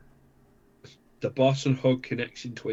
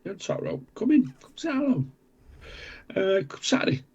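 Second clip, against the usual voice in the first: much higher, sing-song welcoming call; mock calling out; 9 semitones higher, 4 dB louder than usual. Host playfully calling a commenter to come in, high sing-song. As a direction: beckon him in like a cheery host.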